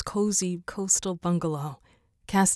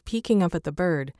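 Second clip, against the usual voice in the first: plain reading voice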